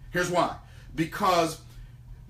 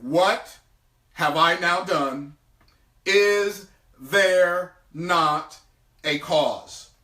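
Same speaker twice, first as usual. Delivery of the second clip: slow, deliberate question; solemn slow reading; 3 semitones lower, much slower than usual. Acted. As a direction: draw it out, grave and heavy. Reads David's question slowly.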